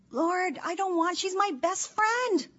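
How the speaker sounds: high, loud, slowed plea near tears; tearful plea. Real, not acted. Mother praying over her daughter leaving, tearful high plea. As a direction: voice climbing as if about to cry.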